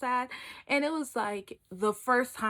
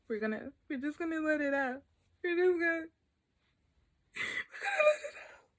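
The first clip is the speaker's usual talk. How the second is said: rising, squeezed fake-tearful voice; mock about to cry; 5 semitones higher, 3 dB quieter than usual. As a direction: force out tears that won't come. Acts out trying to cry.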